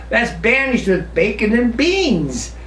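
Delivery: usual speaking voice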